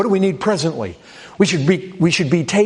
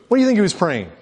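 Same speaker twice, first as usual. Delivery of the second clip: ordinary talk, nothing marked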